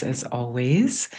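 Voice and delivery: normal talking voice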